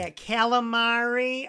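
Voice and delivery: hammed-up slow calamari; mock calamari. Shown as a demonstration. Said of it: "I've ordered calamari".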